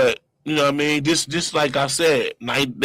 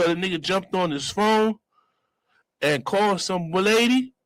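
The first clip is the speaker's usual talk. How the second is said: normal talking voice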